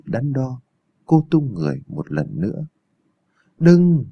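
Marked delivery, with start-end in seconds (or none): 3.6-4.0 loud, higher cry to stop her; one sharp yell, pitched up; alarmed shout. A narrator's reading. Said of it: "Đó là tiếng hét của hắn".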